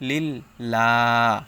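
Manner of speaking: slow "লিল্লাহ" with open, held a; light lam. Shown as a demonstration. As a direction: stretch the thin la in "লিল্লাহ". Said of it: "লা উচ্চারণ হয়েছে". ("লিল্লাহ"-তে পাতলা "লা", টেনে)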